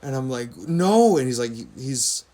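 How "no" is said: loud, high cry of retold disbelief; upset disbelief. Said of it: "he can tell that I'm really upset".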